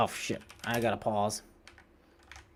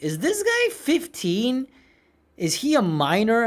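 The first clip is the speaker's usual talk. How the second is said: high, drawn-out mock disbelief; incredulous commenters; 7 semitones higher, 4 dB louder than usual. Imitating viewers doubting his age, mock incredulous. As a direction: mimic puzzled commenters, pitched up. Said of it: "people often assume, like".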